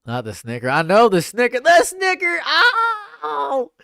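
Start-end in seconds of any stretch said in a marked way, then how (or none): 0.7-2.4 from raised to shrieking high; building outburst. Acted. 2.5-3.7 very high, hammed-up rage sobbing; crying with rage. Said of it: "That's how I felt when I lost".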